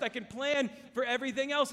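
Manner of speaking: ordinary talk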